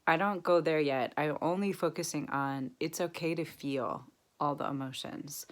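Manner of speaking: ordinary talk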